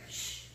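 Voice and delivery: soft hushing hiss; shushing. Real, not acted. Reader quiets Delilah during the story, shushing. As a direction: gently shush someone mid-story.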